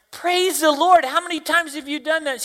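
high, joyful retold exclamation; excited. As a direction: burst out in delight. Preacher's delighted reply retold.

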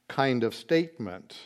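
ordinary talk, nothing marked

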